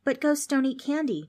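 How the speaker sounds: put-on a kid pointing out the obvious; lightly voiced smart aleck; know-it-all kid. Darren corrects ghost.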